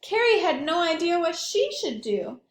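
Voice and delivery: plain reading voice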